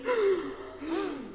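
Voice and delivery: sharp astonished gasp on cue; group gasp. Pretend. Leader cues the group to gasp in astonishment. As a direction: gasp in wonder with the class. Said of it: "Can you gasp with me?".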